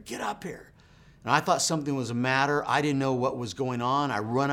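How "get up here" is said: hushed, breathless whisper, no voice at all; urgent whisper. Retold or imitated. Wife whispering upstairs for her husband to come, in an urgent whisper. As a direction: whisper it as if someone might wake. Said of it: "my wife calls me from upstairs".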